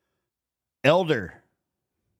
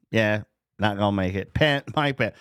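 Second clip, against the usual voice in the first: usual speaking voice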